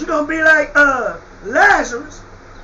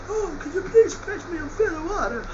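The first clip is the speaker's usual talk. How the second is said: much softer, affected genteel plea; mock the prim storybook version; prissy mock-proper beggar; prim mock-polite voice; 3 semitones higher, 8 dB quieter than usual. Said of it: "That's how they try to tell the story".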